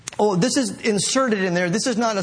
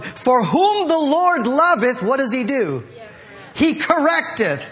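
forceful scripture preaching; 5 semitones higher, 3 dB louder than usual. Acted. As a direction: proclaim the verse, pressing the congregation for the answer. Preaches through verse in raised voice.

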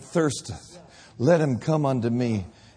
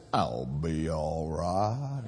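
slow, low southern drawl, laughing; 10 semitones lower, 7 dB quieter, much slower than usual. Retold or imitated. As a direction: drag it out like a southern guy at the bar. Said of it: "Can't even do it in a northern accent".

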